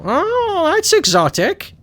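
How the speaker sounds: put-on high-pitched cooing old lady. Old ladies hearing the accent on TV, cooing. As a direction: coo it high, like a delighted old TV viewer. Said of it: "doesn't offend old ladies when they turn on".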